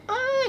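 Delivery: imitated moan. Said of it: "kayak merintih kesakitan gitu".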